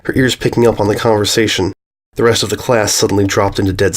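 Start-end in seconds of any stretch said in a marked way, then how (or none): none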